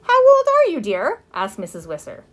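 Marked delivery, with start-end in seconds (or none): 0.0-1.2 put-on very high, loud question to a child; exaggerated talking-to-a-child voice; cooing neighbor. A narrator's reading. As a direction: coo at a little girl, then drop on "dear". Neighbor quizzes little girl.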